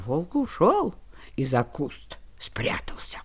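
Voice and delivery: hushed whisper. A narrator's reading. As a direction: whisper it like a secret. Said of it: "narrator drops to a whisper".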